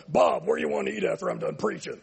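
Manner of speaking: put-on voice of a manly woman preacher; mock a preacher calling out to a spouse; brash, hammed-up impression; Joyce Myers. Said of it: "Joyce Myers comes on".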